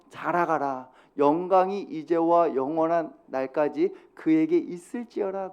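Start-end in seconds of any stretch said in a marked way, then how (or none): 1.2-5.5 느리고 또박또박 읽는 성경 봉독; 회중과 함께 천천히 말씀을 읽듯이. Acted. Reads scripture aloud together. (회중과 함께 성경을 천천히 또박또박 낭독)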